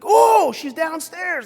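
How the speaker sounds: loud, slow urgent realization; urgent call. Retold or imitated. Father realizing the scream is downstairs, loud urgent call. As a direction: it hits you where she is. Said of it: "I'm like".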